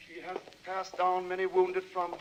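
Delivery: solemn, intoned Bible reading; reading scripture. Acted. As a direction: read the verses like a sermon. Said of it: "I do a great deal of studying in my book here, the Holy Bible".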